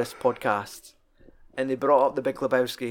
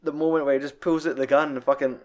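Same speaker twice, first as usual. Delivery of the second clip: ordinary talk, nothing marked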